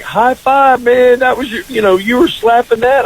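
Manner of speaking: high-pitched, hyped-up mock bro; obnoxious guy. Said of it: "that obnoxious guy".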